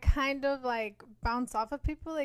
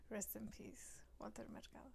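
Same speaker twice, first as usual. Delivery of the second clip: whispered; 2 semitones lower, 21 dB quieter than usual. Real, not acted. Paying respects to a dead star.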